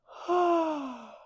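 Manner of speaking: big high yawning sigh; demo yawn. Shown as a demonstration. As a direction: give a full audible yawn. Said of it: "and then yawn".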